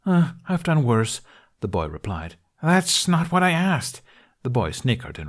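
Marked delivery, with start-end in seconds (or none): 0.1-1.2 breathless. Acted. Boy replies mid-chase. 2.6-4.0 exasperated. Acted. Hiccup retorts while fleeing.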